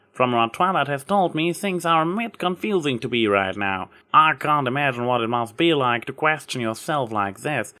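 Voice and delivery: a hint of a country farm mare, kindly; lightly voiced Applejack. A narrator's reading. Applejack brushing off Trixie's apology, lightly voiced country mare.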